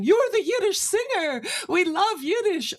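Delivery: high-pitched, gushing imitation of admirers; excited fans. Airport fans rushing up to the Yiddish singer, excited imitation. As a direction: mimic thrilled fans squealing a compliment.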